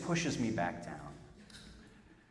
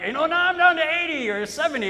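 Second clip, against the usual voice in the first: high-pitched mock worry, a little louder; worried quote; 11 semitones higher, 8 dB louder, faster than usual. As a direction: whine about the dropping battery. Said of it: "I'm like".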